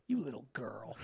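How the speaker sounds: low, soft mocking sneer; contemptuous put-down. Host imitates Alec Baldwin's low scornful put-down. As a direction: drop low and quiet, full of scorn. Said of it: "glared at him in the eye, and said".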